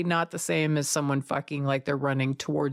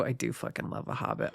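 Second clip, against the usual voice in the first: whispering; 6 semitones lower, 7 dB quieter than usual. Real, not acted. Admitting a guilty crush.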